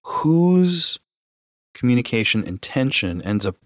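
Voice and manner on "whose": ordinary delivery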